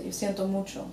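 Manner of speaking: siento mucho with ye glide and ch; softly, siento mucho as SYEN-to MOO-cho; Spanish-speaker version. Shown as a demonstration. Co-host shows what Spanish speakers say, Spanish-sounding siento mucho. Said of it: "Spanish speakers say".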